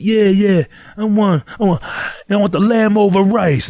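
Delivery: put-on high, loud New York accent, slowed at the end. Guy ordering lamb over rice in a New York accent. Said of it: "Fucking total New York accent".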